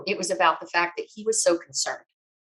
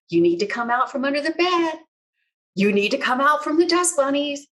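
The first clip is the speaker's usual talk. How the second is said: lightly voiced upbeat friend, rising higher; a hint of a cheerful friend calling on the phone; cheery Bob; 6 semitones higher than usual. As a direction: coax her out playfully, higher pitched. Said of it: "he's like".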